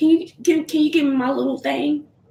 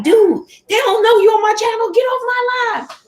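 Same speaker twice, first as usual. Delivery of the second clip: high, loud mock scolding of her son; 12 semitones higher, 5 dB louder than usual. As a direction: shoo him off the live, high and loud. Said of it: "My son, I was like".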